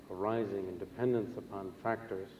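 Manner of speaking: ordinary delivery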